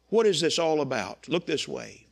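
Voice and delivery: ordinary delivery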